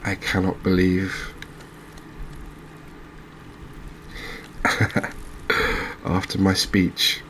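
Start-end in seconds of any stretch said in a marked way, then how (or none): 3.6-6.0 soft, breath-only laugh of disbelief; real laugh. Real, not acted. Angler caught an eel after his speech, helpless laugh.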